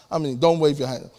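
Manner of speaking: normal talking voice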